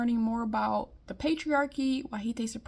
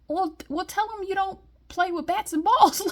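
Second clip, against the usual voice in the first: high, louder mock line with a sly tease; higher, loud playful mock reply; mocking suggestion; 8 semitones higher, 6 dB louder than usual. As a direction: tease him with the line you'd hand him.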